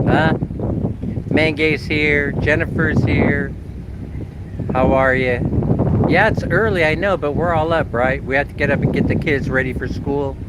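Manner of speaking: ordinary delivery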